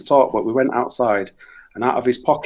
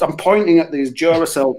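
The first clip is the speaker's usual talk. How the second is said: ordinary delivery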